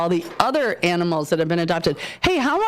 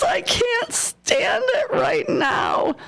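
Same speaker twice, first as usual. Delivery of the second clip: excited squeal; 14 semitones higher, much slower than usual. Real, not acted. Squealing over a cute dog.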